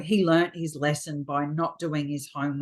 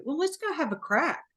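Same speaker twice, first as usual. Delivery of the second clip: breezy encouragement; 7 semitones higher than usual. Retold or imitated. Acting out her breezy attitude.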